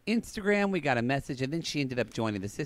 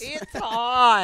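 high, louder whine; whiny sissy boy; 9 semitones higher, 7 dB louder than usual. Retold or imitated. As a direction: complain like a fussy boy. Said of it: "that sissy boy going".

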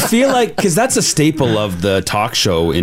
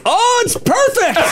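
delighted squeal; slower than usual. Real, not acted. Delighted reaction to workstation photo.

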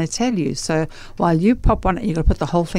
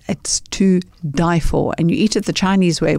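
normal talking voice

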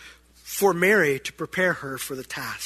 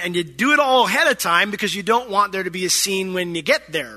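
ordinary talk, nothing marked

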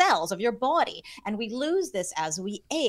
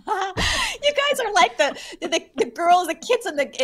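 laughing; 11 semitones higher, 4 dB louder than usual. Real, not acted. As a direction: laugh as you say it. Laughing at friends.

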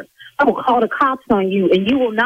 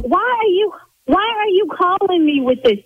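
raised, high-pitched exasperation; yelling; 8 semitones higher than usual. Real, not acted. Salon owner demands why he is calling her, yelling in exasperation. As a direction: demand an answer, voice pushed up high. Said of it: "See, now I'm yelling".

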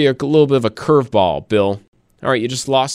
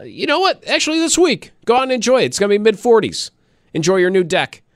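excited; 8 semitones higher than usual. Real, not acted. Cheery upbeat pep talk.

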